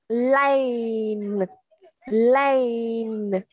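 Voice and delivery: LANE drawn out long and slow; slow reading. Shown as a demonstration. Teacher showing students the slow reading, LANE drawn out. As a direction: stretch the word LANE right out. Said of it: "khi chúng ta đọc chậm".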